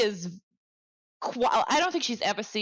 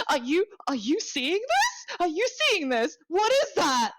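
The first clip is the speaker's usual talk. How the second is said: panicked gasp; 8 semitones higher than usual. Acted. Intern panics at the monster.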